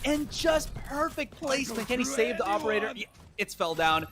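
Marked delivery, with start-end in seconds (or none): 0.0-2.9 excited esports caster. Real, not acted. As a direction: marvel at the play. Caster erupts at a clutch play.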